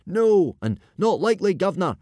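high loud shouts from the crowd; crowd cries. A narrator's reading. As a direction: yell back in eager agreement. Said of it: "Cries of".

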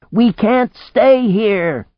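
high, drawn-out full shout; loud high-pitched yell, words stretched out. A narrator's reading. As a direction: shout it slowly over a roaring storm. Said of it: "she shouted".

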